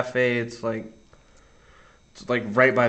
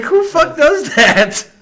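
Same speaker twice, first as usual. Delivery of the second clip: mock outrage; 9 dB louder, much slower than usual. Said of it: "It's like".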